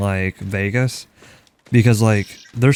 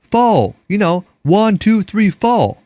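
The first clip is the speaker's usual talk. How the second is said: "Four" said high and loud, stretched into a thick Texan "Fo-wer"; Texan accent; 10 semitones higher, 6 dB louder, slower than usual. Shown as a demonstration. Showing a friend how the Texan said "Four", in a loud high mock Texan accent. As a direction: count out "one, two, three, four" in a loud high Texas twang. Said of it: "how do you say it?".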